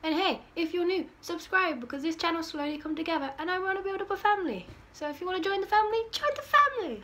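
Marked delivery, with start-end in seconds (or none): 4.9-7.0 excited invite. Real, not acted. Inviting viewers to join.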